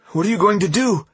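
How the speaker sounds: high, alarmed demand; anxious; alarmed question. A narrator's reading. Thomas alarmed as gun is cocked.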